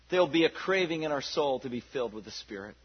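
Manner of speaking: normal talking voice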